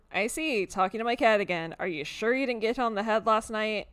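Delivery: loud, sharp and sarcastic; stern Tabitha. Acted. Woman mocking her cousin for talking to a cat, sarcastic.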